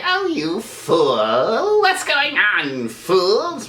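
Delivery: slow, casual villain voice without rage; out-of-character villain. Shown as a demonstration. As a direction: draw it out casually, missing the villain's fury. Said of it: "He's not gonna say".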